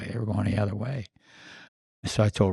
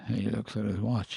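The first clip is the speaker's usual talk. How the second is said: ordinary talk, nothing marked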